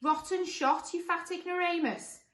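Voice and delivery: high, loud jeer; high-pitched taunting character; monster calling out. Acted. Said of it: "called the first monster".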